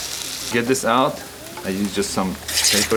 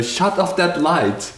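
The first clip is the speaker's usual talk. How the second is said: higher, exasperated retold plea; retold agitation; 6 semitones higher than usual. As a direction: protest as if agitated, pitched up. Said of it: "but I was agitated".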